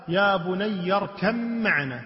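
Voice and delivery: "اركب معنا" with the ب merged into a held م; assimilated mim. Shown as a demonstration. Teacher showing the Hafs reading, assimilated mim. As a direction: say "اركب معنا" as irkam-ma'ana, humming the doubled m. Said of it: "بالميم".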